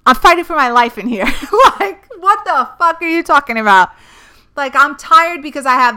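fed up with questions about her tiredness, shouting through a laugh; furious laughing outburst. Real, not acted. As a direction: blow up in disbelief.

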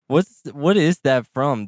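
ordinary talk

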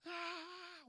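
high-pitched mock scream, held back in volume; imitated scream. Storyteller imitating the boy screaming across the street, restrained high scream. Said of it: "um grito muito alto".

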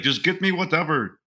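put-on Indian accent, pitched high; mock Indian accent. Indian prince at a dorm party asking for whatever, mock Indian accent. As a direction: imitate an Indian student in a high voice. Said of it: "giving this guy an accent".

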